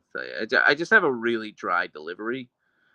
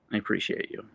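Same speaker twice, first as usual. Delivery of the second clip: ordinary talk, nothing marked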